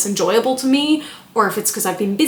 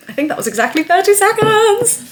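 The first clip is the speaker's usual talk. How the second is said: excited; 12 semitones higher, 5 dB louder than usual. Real, not acted. Vlogger finishing her thirty-second script, excited. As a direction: pitch climbing high in excitement.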